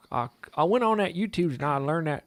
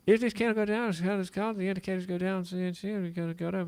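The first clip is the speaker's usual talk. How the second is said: higher, mumbled imitation of a trader girl; mimicking the hard-to-hear Emma the Mindfully Trader; imitating Emma; 6 semitones higher, 5 dB louder than usual. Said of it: "she'd be like".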